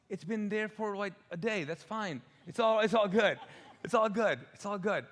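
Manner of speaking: high, loud protest that it's fine; mock pleading. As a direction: whine it high, brushing her off. Pleading with wife over meat.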